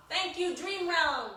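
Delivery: high, loud, slow thanks; grateful exclaim. Retold or imitated. Thanking the Dream Realm, a high, loud, slow proclamation. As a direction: proclaim it slowly to the dream world. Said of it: "and said".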